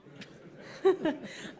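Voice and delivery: short breathy laugh; real laugh at an awkward interruption. Real, not acted. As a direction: laugh it off briefly. Apologizing after husband's phone call interrupts.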